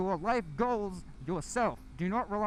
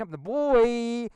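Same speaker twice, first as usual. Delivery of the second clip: drawn-out shout; 2 semitones higher, 9 dB louder, much slower than usual. Acted. Catchphrase punched as a climax.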